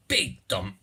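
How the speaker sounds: ordinary talk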